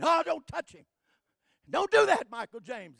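high, loud retold begging; pleading shout. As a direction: beg him not to go near it. Brother begging, crying.

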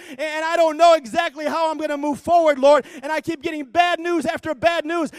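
loud, rising, fervent preaching; full-force preaching. Acted. Preacher assuring the church God is with them, loud and building. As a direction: build it up to a roar for the congregation.

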